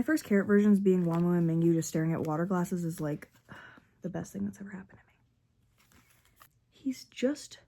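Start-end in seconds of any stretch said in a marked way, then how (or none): 3.0-7.6 softer, unvoiced, overwhelmed; whisper. Real, not acted. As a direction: whisper it, overcome.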